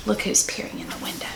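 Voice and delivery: fast, breathy secret whisper. Acted. Narrator whispering to the listeners about the window. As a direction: lean in and whisper the surprise.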